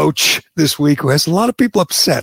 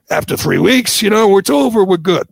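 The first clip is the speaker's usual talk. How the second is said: higher, louder, faux-cheerful optimism; mock naive relief; 6 semitones higher, 3 dB louder than usual. Host mocking his own naive hope, high and louder. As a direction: sound like you just believed the lockdown was over. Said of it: "I thought".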